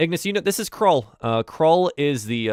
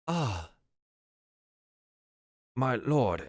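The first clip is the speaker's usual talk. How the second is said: a hint of a deferential old servant; lightly voiced old goblin butler; 3 semitones higher, 4 dB quieter, slower than usual. Old butler greets his returning lord, lightly deferential. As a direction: greet your master softly and reverently.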